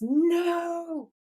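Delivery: long, high drawn-out mock cry; Dean crying out. Brother sees Sam stabbed from behind, crying out. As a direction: stretch the word into a high wail. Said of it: "and Dean goes".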